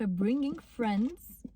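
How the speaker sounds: slow pronunciation practice; very slow drill. Shown as a demonstration. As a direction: drag out "bringing friends" very slowly. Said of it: "قوليها كذا مرة".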